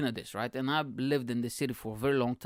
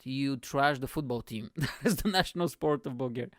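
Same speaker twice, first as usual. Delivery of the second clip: ordinary delivery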